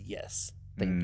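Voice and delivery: slow, low, kingly thanks; regal acceptance. Retold or imitated. Jesus answering the pilot's offering, regal and gracious. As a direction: accept it like a king receiving gold. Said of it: "received it in the same exact way, with the same type of honor".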